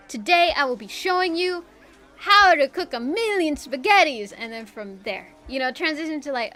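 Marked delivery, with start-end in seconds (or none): 0.1-4.3 louder, bright hyped intro; YouTuber intro. Retold or imitated. Explaining how videos open, hyped YouTuber intro voice. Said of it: "start off a video like".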